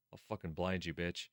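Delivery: low, soft aside of menace; muttered threat. Real, not acted. Streamer threatens narrator who stared at Satoshi-kun, muttered threat. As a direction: mutter it darkly under the reading.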